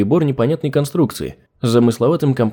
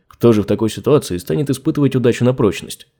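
usual speaking voice

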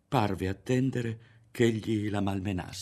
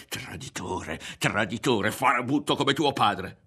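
angry insults, building; 3 semitones higher, 4 dB louder than usual. A narrator's reading. Woman wrestling Ario and insulting him, building fury. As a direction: start held in, then explode.

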